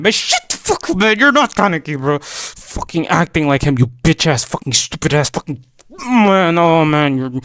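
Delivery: loud outburst, starting high-pitched; hammed-up fury breaking into exasperated groaning; angry rant. Fan cursing a manga character as an impostor, angry rant.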